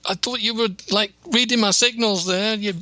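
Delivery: high, flustered bride's excuse; defensive reply. Retold or imitated. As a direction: plead your case to your new husband. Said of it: "groom turns to the bride and goes".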